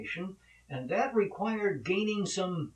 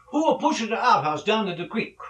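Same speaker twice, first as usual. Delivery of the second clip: raised, loud mock Italian father; bad Italian accent; 5 semitones higher, 6 dB louder than usual. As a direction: demand it in a hammed-up Italian accent. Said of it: "my Italian accent sucks".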